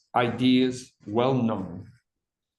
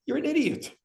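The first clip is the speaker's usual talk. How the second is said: scornful jab; 13 semitones higher than usual. Retold or imitated. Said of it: "the guy who doesn't care about anything goes".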